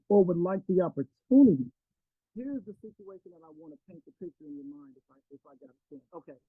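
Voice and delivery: thumb over the phone mic; muffled, covered microphone. Real, not acted. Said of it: "I have my thumb over my phone".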